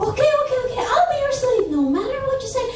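high, hurried acted submission; frantic agreement. Brother hastily agrees to sister's condition.

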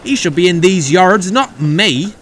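loud, high, resentful outburst; scoffing. A narrator's reading. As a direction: build to an indignant shout. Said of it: "scoffed Oliver".

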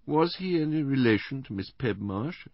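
high-voiced invalid wife; Missus Bland, plaintive. A narrator's reading. Invalid wife checking her memory with her husband, plaintive. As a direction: answer in a high, delicate, plaintive tone.

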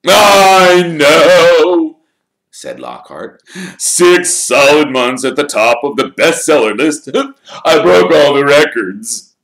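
slow, high and loud showman's voice; vain Lockhart. Acted. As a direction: preen like a celebrity signing photos.

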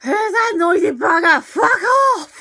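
loud, hammed-up irritation; mock angry yell. Viewer telling a noisy character off, mock angry yell.

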